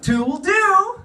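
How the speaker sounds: high, prim falsetto inner voice; squeaky little voice of restraint; internal Alex. Acted. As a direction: chirp it as a self-restraining conscience. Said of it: "My internal Alex is like".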